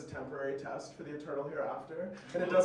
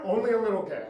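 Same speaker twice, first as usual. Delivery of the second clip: higher, louder sales pitch; projected mock announcement; 5 semitones higher, 7 dB louder, faster than usual. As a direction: call it out to casting directors. Repeated for any casting directors.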